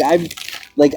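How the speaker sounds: ordinary talk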